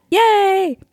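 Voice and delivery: bright high cheer; excited. Real, not acted. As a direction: cheer it out, delighted. Cheering about where they met.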